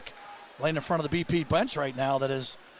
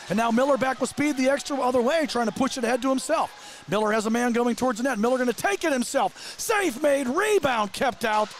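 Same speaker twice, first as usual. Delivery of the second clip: excited play-by-play; 8 semitones higher than usual. Real, not acted. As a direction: build the call as the save comes. Goal-mouth scramble called live.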